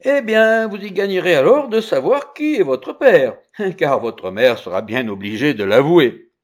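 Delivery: put-on emphatic young Merlin; bold child retort. A narrator's reading. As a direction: answer back forcefully.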